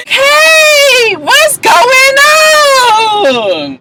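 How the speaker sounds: high squealed, drawn-out imitation; mock drunken greeting. Recapper imitating Shay at the bar, mock high squeal. Mimicking a wild, loud reality-show woman.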